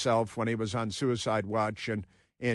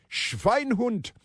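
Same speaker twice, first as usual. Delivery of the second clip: put-on German-accented insult, pitched up high; mock German; 10 semitones higher than usual. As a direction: bark it in a hammed-up German accent. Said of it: "And it's a little Deutsche".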